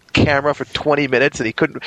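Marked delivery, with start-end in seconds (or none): none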